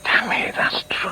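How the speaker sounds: hushed whisper of a private thought; whispered aside. Acted. Man whispering his inner thoughts during a debate. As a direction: whisper it to yourself, unheard by the others.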